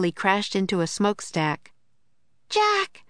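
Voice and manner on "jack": put-on very high girl's call; Annie crying out. A narrator's reading. Annie calling Jack in the dark, high cry. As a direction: call the name high and urgent. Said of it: "cried Annie".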